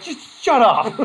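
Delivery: mock-annoyed outburst; exasperated snap. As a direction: bark it at the class to shut them up. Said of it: "I'm like".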